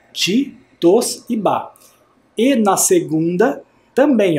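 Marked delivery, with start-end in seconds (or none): 0.1-1.7 slow, one syllable at a time; slow syllable reading. Shown as a demonstration. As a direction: read "ti, tos e ba" piece by piece. (devagar, sílaba por sílaba)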